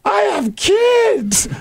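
very high, slow, wailing mock plea; over-dramatic woman. Mocking the crash woman's plea, high slow wail. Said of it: "She goes".

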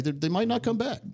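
normal talking voice

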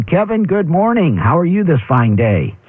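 ordinary delivery

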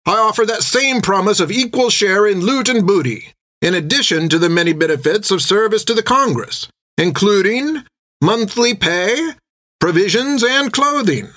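rally the room like a proud naval officer; a sea captain's ringing pitch to a crowd; recruiting sea captain. Acted.

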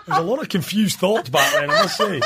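embarrassed giggle breaking through; laughing. Real, not acted. Host laughing at his own cartoon crush, giggly.

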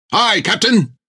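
high, stiff, at-attention voice; eager sailor voice. Acted. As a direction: report like a keen recruit.

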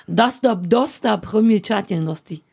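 достопримечательности spelled out slowly, syllable by syllable; slow demo of достопримечательности; slow Russian word. Shown as a demonstration. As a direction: say достопримечательности slowly so it can be memorised. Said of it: "Das muss so langsam".

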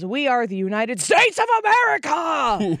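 speech-ending yell, rising high; imitated yelling. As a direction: build to a mock politician's shout. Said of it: "and yells".

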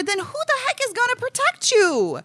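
very high-pitched, impassioned challenge; worked-up rant. Real, not acted. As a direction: rant protectively, pitch climbing right up. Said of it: "went on my little, my little rant".

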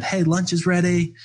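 high-pitched mock call-out; imitation of a distant yell, not full volume; imitated yell. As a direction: call folks in to lunch, lightly mimicked. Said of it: "someone yelled from".

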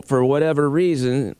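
ordinary delivery

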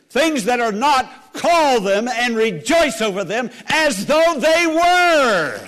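loud, high, slow climactic proclamation; preacher's ringing climax; full-force preaching. Real, not acted. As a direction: build to a long, shouted last word. Climax of the sermon.

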